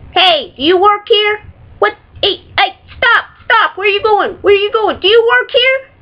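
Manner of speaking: high, shouted impatient customer voice; harsh barking commands. Retold or imitated. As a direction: bark at the clerk like a rude customer. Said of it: "somebody will be like".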